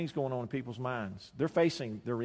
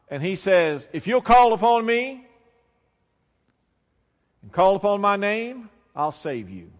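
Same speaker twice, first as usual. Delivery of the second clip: loud, slow, raised pulpit proclamation; impassioned sermon climax, loud and drawn out; full-force preaching; 4 semitones higher, 10 dB louder, slower than usual. Real, not acted. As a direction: proclaim it to the whole congregation, voice climbing.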